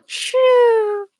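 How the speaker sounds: high fake sleepy shooing; hammed-up fake sleeper, waving off; pretending to sleep. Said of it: "fake snoring".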